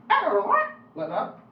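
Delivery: high squeaky chipmunk error. Shown as a demonstration. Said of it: "say the word error in the same voice".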